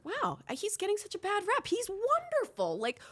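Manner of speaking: very high-pitched gushing wonder; hammed-up clueless praise, drawing it out; mock naive admiration. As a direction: gush like a starry-eyed innocent, way up high. Acting out her naive former admiration.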